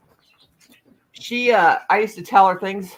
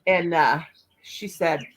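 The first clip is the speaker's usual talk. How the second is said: normal talking voice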